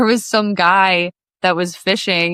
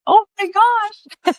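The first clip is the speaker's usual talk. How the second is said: much higher, slow, drawn-out oh my gosh; mock shocked gasp; much slower than usual. Pushed off the boat by grandpa, a stunned slow gasp. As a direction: act stunned, stretch each word.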